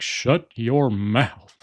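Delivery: very slow, drawn-out scolding dad; outraged dad impression, word by word; Hank Hill. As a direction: imitate an appalled cartoon father, stretching each word. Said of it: "Hank will just flip out".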